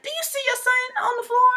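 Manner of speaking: acted, high-pitched incredulous appeal; confused disbelief. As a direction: re-enact it squeaking in bewilderment. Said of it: "'Cause I was so confused".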